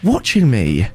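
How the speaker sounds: insist, badly rattled and exasperated; agitated insistence. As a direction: pitch climbing in worked-up disbelief. Insisting on phone about scarecrow.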